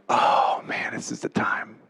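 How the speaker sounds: hushed, breath-only whisper to himself; dreading whisper. Retold or imitated. Last jumper hearing his name called, dreading whisper. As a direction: whisper it as your turn arrives.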